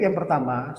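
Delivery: normal talking voice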